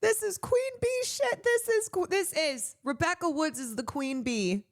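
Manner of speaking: near tears. Real, not acted. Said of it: "I'm actually gonna start crying here".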